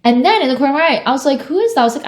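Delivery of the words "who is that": surprised. Retold or imitated. Spotting a face in the crowd.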